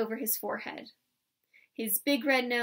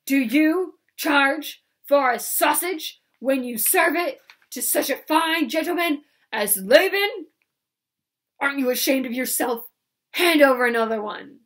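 loud, higher mocking bully voice; bullying roar; 4 semitones higher, 9 dB louder than usual. A narrator's reading. Laban mocking the old man, loud bully. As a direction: sneer and bellow at him. Laban bullies the sausage seller.

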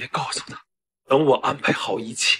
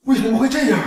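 shocked; 12 semitones higher, 4 dB louder, faster than usual. Acted. Father stunned by the secret.

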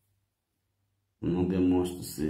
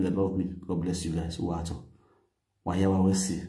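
ordinary talk

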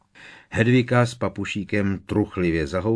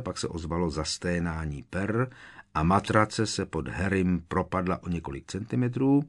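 ordinary delivery, nothing marked

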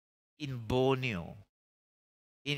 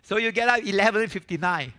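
sudden loud, high holler; hollering revival preacher; 6 semitones higher, 10 dB louder than usual. Acted. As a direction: belt it out at the crowd.

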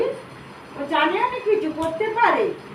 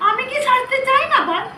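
reproachful shout; 6 semitones higher than usual. Acted. Goddess scolding devotees, shouting.

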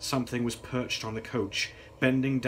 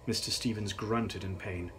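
ordinary delivery

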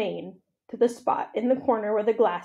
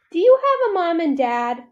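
put-on high, louder child's question; curious child; 8 semitones higher, 7 dB louder than usual. A narrator's reading. Ruby asks Ivan about parents, high and curious. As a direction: blurt it like a small child.

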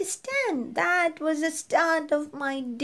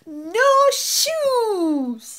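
put-on loud, higher child's cry; shouting; 7 semitones higher, 5 dB louder than usual. Girl refusing her shoes, loud tantrum shout. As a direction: yell it as a defiant tantrum.

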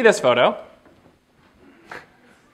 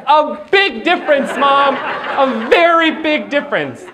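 higher, slow weighty insistence; emphatic correction; 10 semitones higher, 3 dB louder, slower than usual. Retold or imitated. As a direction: lean on big difference.

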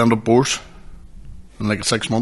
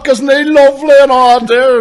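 mimicking the boy; 11 dB louder than usual. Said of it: "He goes".

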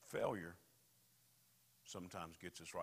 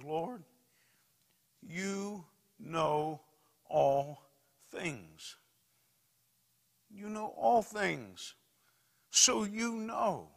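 high, loud, slow drawn-out cry; anguished plea; 10 semitones higher, 13 dB louder, much slower than usual. Acted. Peter answering Jesus the third time, anguished slow cry. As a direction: pour out the heart, breaking.

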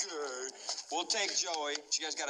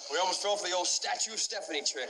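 normal talking voice

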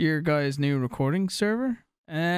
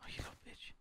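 whispered taunt; 23 dB quieter, faster than usual. Pretend. Taunting a player in a whisper, voiceless whisper. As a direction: breath-only whisper of the insult.

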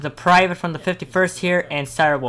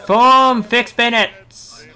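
high, loud, slow command; shouting orders over battle; 7 semitones higher, 7 dB louder, much slower than usual. Acted. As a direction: drag out the order.

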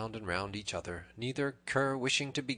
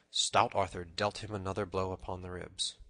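ordinary delivery

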